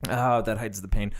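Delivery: ordinary delivery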